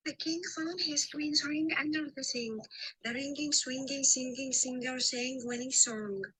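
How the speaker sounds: careful English ng practice; ng sound drill. Shown as a demonstration. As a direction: sound out every ng in king, ringing, singing. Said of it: "ادي سالي، اسمع".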